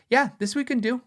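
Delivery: lightly voiced German bass maker saying yes; Warwick staff impression. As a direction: chirp it like a keen factory rep. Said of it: "I love doing this impersonation".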